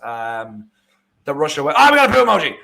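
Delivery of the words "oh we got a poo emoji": high, loud interruption of own commentary; excited outburst. Real, not acted. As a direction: break off and exclaim in delight. Sudden reaction to chat emoji.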